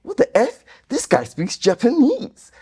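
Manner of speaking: high, slow incredulous gasp; mock shock. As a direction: act out a stunned commenter. Mock shocked commenter quote.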